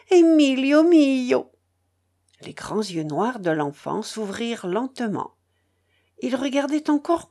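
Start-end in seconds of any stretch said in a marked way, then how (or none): 0.1-1.5 high, loud, drawn-out call; young woman's cry. A narrator's reading. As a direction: cry out the beloved name, stretching it. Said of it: "cria la jeune fille". (cri ému, aigu et prolongé)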